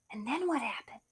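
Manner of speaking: softly whispered question. Real, not acted. Asking Beauty about the rock star, whispered. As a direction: whisper it, curious.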